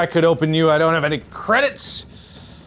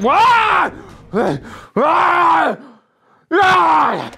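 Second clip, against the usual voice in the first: short high-pitched yells; 10 semitones higher, much slower than usual. Real, not acted. Player bursting out mid-game, high yells. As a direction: let out sudden high cries. Yelling out during play.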